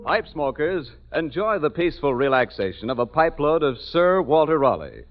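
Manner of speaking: normal talking voice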